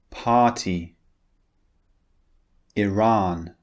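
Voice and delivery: each word said slowly and clearly for repeating; British long ah in each word; model word list. Shown as a demonstration. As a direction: model words for learners to repeat. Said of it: "Listen and repeat after me".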